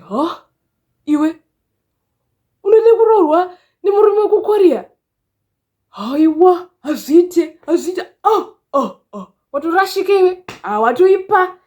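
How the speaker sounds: high, loud, scandalized dad; dad impression. Father reacting to daughter wanting a Korean husband, high-pitched impression. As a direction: imitate an incredulous father scolding his daughter. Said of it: "that's the perfect impression of my dad".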